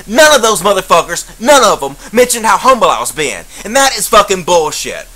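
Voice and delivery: ordinary talk, nothing marked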